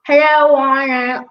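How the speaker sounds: loud, drawn-out line as if round a cork; words muddied by an imaginary cork; cork-in-mouth speech. Shown as a demonstration. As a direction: say it slowly with the mouth propped open. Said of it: "put a cork in my mouth like this".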